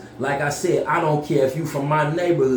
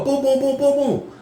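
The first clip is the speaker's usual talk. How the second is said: high-pitched rapid booms; imitated door banging; 12 semitones higher than usual. Storyteller acting out banging on the bathroom door, imitated booms. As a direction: mimic pounding on a door. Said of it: "So I go bang on the door".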